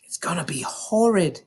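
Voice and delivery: breathless, voiceless whisper; hushed whisper to a friend in assembly. A narrator's reading. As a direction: whisper it so no one hears. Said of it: "she whispered back".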